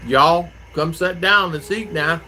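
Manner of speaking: slow, mild Southern drawl as his mother; imitating his mother's slow Southern call to dinner, lightly; Southern mother imitation. Imitating his mother calling the family to eat, slow mild Southern accent. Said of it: "my mother was like that".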